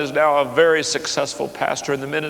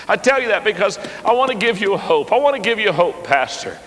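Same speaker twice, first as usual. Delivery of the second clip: louder, higher pulpit appeal; full-force preaching; 8 semitones higher, 4 dB louder than usual. Acted. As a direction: urge the congregation with rising fervour. Preaching appeal to pastors and laypeople.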